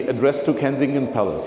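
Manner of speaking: normal talking voice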